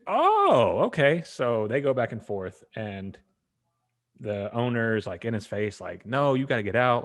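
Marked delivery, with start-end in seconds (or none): 0.1-1.2 high, loud drawn-out "oh"; retold indignant surprise, pitched way up; mock realization. Retold reaction to owner's insult. 6.1-7.0 louder, higher eviction order; mock confrontational; retold angry order. Owner in his face, retold.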